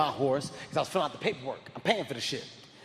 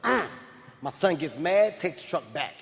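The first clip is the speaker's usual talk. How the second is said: ordinary delivery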